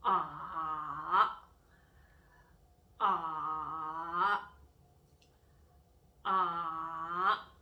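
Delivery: long "Ah" dipping low, then curving up; twisted third tone. Shown as a demonstration. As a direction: push "Ah" low and deep before it rises. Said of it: "go low and go deep".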